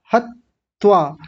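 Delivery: "हत्वा" drawn out with a doubled त; mistaken doubled consonant. Shown as a demonstration. Teacher imitating students' error, doubled त in "हत्वा". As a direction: say "हत्वा" slowly as hat-twaa, holding the t. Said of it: "जैसे दो त बोल रहे".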